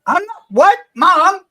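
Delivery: loud, high call to Mom in the next room. Real, not acted. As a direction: shout back over your shoulder. Mom interrupts during stream.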